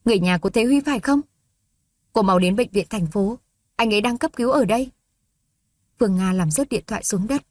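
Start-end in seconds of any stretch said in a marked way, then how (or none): 0.1-4.9 fast, urgent call from the hospital; hospital caller urgently summoning family; hurried woman's voice. Acted. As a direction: rush the news out. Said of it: "một giọng nữ gấp gáp".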